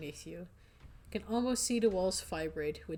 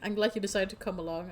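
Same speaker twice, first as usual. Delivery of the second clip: ordinary talk, nothing marked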